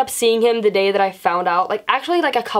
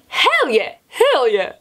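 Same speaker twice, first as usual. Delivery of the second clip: pitched-up, falling hell yeahs; gung-ho; 4 semitones higher, much slower than usual. Retold or imitated. Girl suddenly sold on dating her friend, gung-ho. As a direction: punch out an eager, high cheer. Acts out sudden agreement to date.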